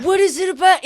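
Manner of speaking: high, loud, pleading demand; exasperated outburst. Acted. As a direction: burst out as if shaking someone. Exasperated character shakes his pupil.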